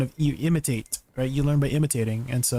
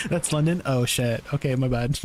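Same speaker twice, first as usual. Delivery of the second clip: usual speaking voice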